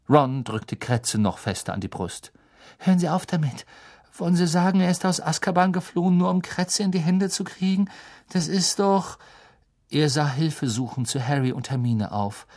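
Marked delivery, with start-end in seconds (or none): 2.8-9.2 put-on higher-pitched, worried boy; unsettled youngster protesting; protesting Ron. A narrator's reading. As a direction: object in a high, incredulous voice.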